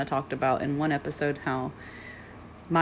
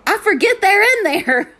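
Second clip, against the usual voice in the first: very high, loud, carrying voice; mock loud apology; 10 dB louder than usual. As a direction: imitate her blaring it out, very high. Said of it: "she literally goes".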